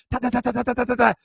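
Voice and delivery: rapid, high imitation of running tap shoes; tap-shoe clatter. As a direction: rattle out quick footsteps with the tongue. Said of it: "They be sound like tap shoes".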